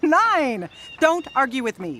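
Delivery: sharp, loud insistence on the number; exasperated shout. Acted. Insisting she eat nine eggs.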